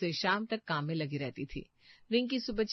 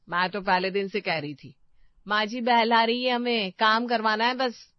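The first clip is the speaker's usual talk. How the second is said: louder, fed-up complaining; loud complaint; 3 semitones higher, 8 dB louder than usual. Acted. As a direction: let the grievance burst out loudly. Daughter-in-law complaining in kitchen. (झुंझलाकर ऊँची आवाज़ में शिकायत)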